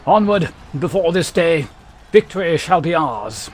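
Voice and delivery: loud soldier speaking inside a helmet. Acted. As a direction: bark it through a helmet. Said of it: "we gotta do the helmet voice for these guys".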